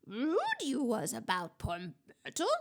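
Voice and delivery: mischievous spirit's put-on voice, goading; goading poltergeist. A narrator's reading. Poltergeist accuses a student of rudeness, goading. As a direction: needle her like a meddling poltergeist. Peeves goading Hermione in story.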